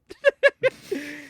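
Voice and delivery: sudden high burst of laughter. Real, not acted.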